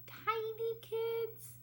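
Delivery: very high, slow little squeak; tiny voice. Acted. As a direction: make yourself sound tiny.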